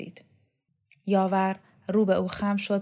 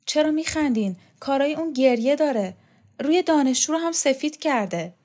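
put-on higher, louder reproachful young voice; indignant girl; 7 semitones higher, 5 dB louder than usual. Laqa reproaches her mother, higher and indignant. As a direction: scold them for laughing.

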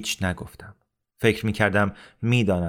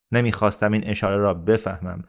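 plain reading voice

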